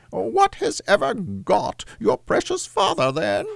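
very high falsetto mother voice; Cratchit's wife. A narrator's reading. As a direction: voice a fretting housewife way up high. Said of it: "said Missus Cratchit".

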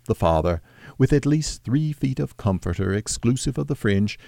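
plain reading voice

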